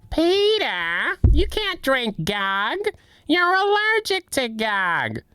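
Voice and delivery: lightly voiced Lois telling him off; Lois impression. Wife scolds Peter in a high slow voice. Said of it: "I can't do any of those".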